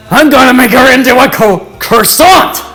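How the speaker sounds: angry rant. Acted. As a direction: spit it out in a rage. Bakugo rants.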